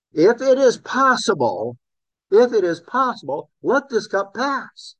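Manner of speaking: high-pitched, urgent plea; acted-out anguished prayer; pleading. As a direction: plead earnestly, pitch lifted well up. Acting out Jesus's plea in Gethsemane.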